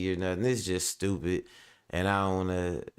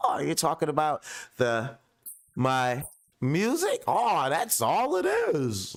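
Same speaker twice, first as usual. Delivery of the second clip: mocking impression; 6 semitones higher, slower than usual. Mocking the rapper's answer.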